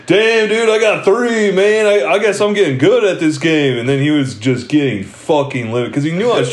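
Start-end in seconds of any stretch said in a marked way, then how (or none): 0.1-3.8 higher, louder mock innocent bragging; smug fake surprise. To drunk uncle at pool, smug mock surprise. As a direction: brag as if the cheating were luck.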